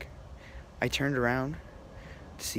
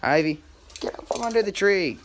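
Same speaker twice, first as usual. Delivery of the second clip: louder and higher command; firm call to the dog; 9 semitones higher, 9 dB louder than usual. Real, not acted. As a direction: order the dog out from under the tree. Ordering dog out.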